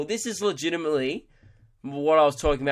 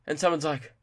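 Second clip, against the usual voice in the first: usual speaking voice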